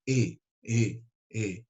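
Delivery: "E" from the back of the throat; throaty vowel. Shown as a demonstration. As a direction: sound "E" deep in the throat. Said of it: "Ele é de fundo de garganta". ("E" de fundo de garganta)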